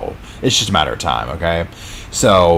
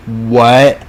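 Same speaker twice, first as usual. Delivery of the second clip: "What?" higher and louder, rising sharply; loud incredulous what; 4 semitones higher, 5 dB louder than usual. Retold or imitated. Narrator echoing the world's shock, loud rising disbelief. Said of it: "the world is like".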